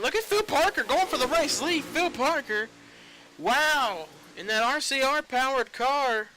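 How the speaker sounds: excited call of the race lead. Real, not acted. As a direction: call the pass like it's huge. Live call of the lead change.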